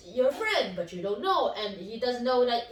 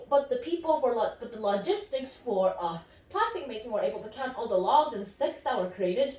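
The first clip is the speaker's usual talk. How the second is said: ordinary delivery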